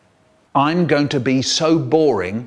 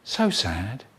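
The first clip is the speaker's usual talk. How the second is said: much softer, lower sigh of sadness; sad; 6 semitones lower, 6 dB quieter than usual. Real, not acted. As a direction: let it sink away. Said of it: "all of me is".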